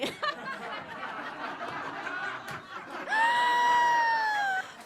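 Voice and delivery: drawn-out high-pitched shriek; long high scream. Acted. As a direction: let out a long comic scream. Scream before outburst about French people.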